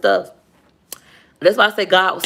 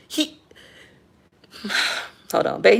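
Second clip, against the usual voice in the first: ordinary delivery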